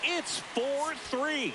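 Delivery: high, very drawn-out score announcement; excited score call. Real, not acted. Announcer gives fans the new lead after a homer, excited. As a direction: stretch the score out in triumph.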